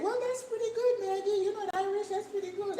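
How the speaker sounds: put-on high, sweet old lady voice; imitating a little old Irish lady, high. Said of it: "Irish little ladies who came to eat it, and they said".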